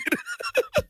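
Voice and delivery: very high, wheezy giggle; laughing. Real, not acted. Comic admitting he watched, high squealing laugh. Laughing at own breastfeeding admission.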